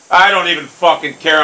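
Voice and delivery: angry dismissal. Real, not acted. Gamer insisting he is over the miss, angry dismissal. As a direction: insist on it with rising temper. Same words, angrier and louder.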